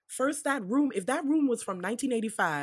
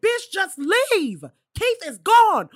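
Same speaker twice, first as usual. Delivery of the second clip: higher, louder, slow exasperated yell; yelling at the screen; 9 semitones higher, 8 dB louder, slower than usual. Retold or imitated. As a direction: yell at her to get out already. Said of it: "I'm like".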